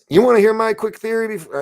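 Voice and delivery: loud, very high eager burst; excited outburst. Real, not acted. Host offering viewers a quick theory before signing off, excited outburst.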